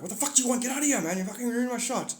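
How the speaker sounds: high, irritated alien yelling at the camera; annoyed grey alien. Pretend. Grey alien interrupting the shot, high and irritated. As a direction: shoo him off as a high-voiced cranky alien. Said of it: "a grey alien must walk onto the screen".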